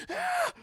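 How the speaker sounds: high-pitched sound of overwhelmed awe; imitated high fanboy gasp; high mock gasp. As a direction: squeal it briefly, retelling the shock. Retelling his stunned first reaction.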